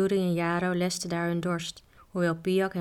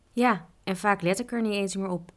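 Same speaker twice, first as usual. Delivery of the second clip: ordinary delivery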